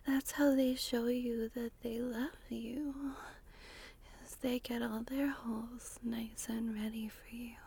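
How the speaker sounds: breathy close-mic ASMR whisper; intimate whisper. Acted. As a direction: whisper it right into the listener's ear.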